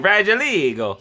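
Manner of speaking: "fragile ego" in a hammed-up mock Italian accent, pitched high. Shown as a demonstration. Joking about his ego, "fragile ego" in mock Italian. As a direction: sing out "fragile ego" like a playful fake Italian. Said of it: "Or as the Italians say".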